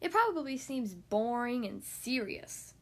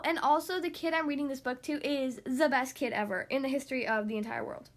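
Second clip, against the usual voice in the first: plain reading voice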